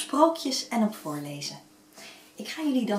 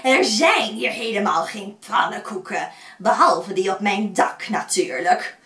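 loud gloating witch voice; 7 dB louder than usual. Acted. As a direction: gloat over the trapped children. Witch gloats over captured children.

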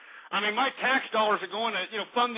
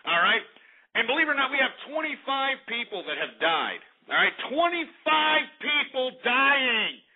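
ordinary talk, nothing marked

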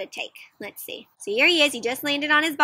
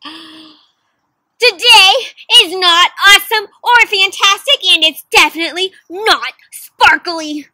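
louder, higher, fed-up outburst; Kevin's true feelings; 5 semitones higher, 9 dB louder, slower than usual. Acted. Kevin admits his bad day, louder and fed up. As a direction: finally let the frustration out. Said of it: "Kevin says".

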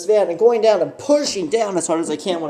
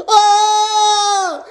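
high, loud mock scream; startled scream; 13 semitones higher, 4 dB louder than usual. Alex spotting the stranger at the window, high loud startled scream. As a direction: shriek in alarm. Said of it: "Alex is like".

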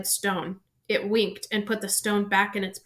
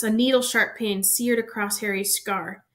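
ordinary delivery, nothing marked

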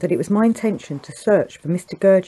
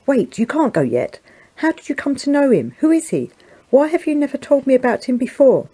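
high, urgent questions in astonishment; astonished; 7 semitones higher, 3 dB louder than usual. A narrator's reading. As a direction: press him, amazed and eager. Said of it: "In the greatest astonishment".

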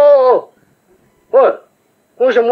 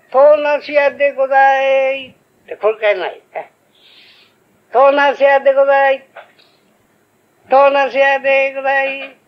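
vendor's cry; 6 semitones higher, 4 dB louder than usual. Acted. Vendor calling out wares.

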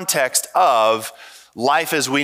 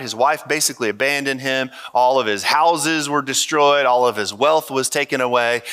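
ordinary delivery

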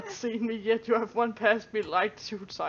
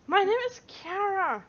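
put-on high kid voice; 9 semitones higher than usual. Kid character insists on name.

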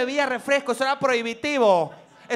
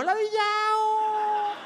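bored; 6 semitones higher, much slower than usual. Retold or imitated. Niño aburrido en fiesta.

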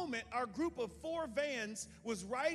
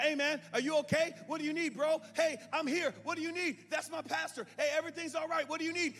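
loud reassurance; 8 semitones higher, 7 dB louder than usual. Retold or imitated. Calming stranger loudly.

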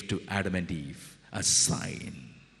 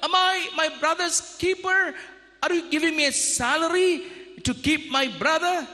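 much higher, defiant, fast in middle; arrogant shout. Acted. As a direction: throw the question back at God. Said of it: "He shouted at God".

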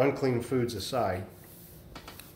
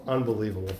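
normal talking voice

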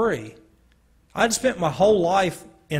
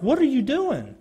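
higher, lightly voiced girl asking in alarm; a hint of a startled girl; the girl's alarm; 9 semitones higher than usual. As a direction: play the puzzled girl in the car, pitched up. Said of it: "she was like".

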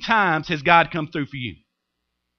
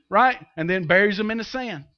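usual speaking voice

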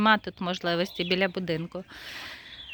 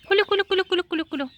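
high sing-song calling to poultry; high repeated call to the turkeys; 9 semitones higher, much faster than usual. Real, not acted. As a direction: call the birds in with a high chant.

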